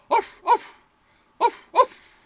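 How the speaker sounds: loud high mock barks; high yappy dog bark. Shown as a demonstration. Teacher barking like a dog, high and loud.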